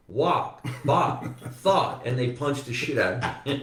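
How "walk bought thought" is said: "walk, bought, thought" higher, with open unrounded vowels; affected standard American. Retold or imitated. Actor back home talking to Jersey friends, affected standard vowels. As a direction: show off the drama-school "walk, bought, thought". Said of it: "I said to my Jersey friends".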